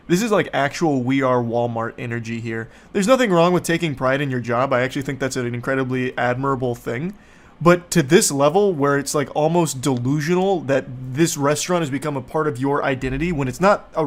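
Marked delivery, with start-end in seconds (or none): none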